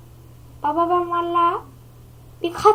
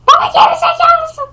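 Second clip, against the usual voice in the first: put-on high, loud child voice, far from his own; a squeaky little boy mocking his dad; cheeky kid voice; 13 semitones higher, 8 dB louder than usual. Kid voice insults father.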